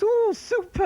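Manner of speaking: high, chirpy posh-mum "Oh, super". Retold or imitated. Comic mimicking his mum reading the party invite, high and delighted. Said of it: "your mum sees the invite and goes".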